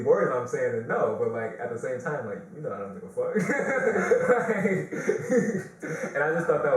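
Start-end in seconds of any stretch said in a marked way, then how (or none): 2.3-6.1 high loud burst of laughter; high laughter fading to breathy giggles; laughing. Real, not acted. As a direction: crack up helplessly. Laughing at the story.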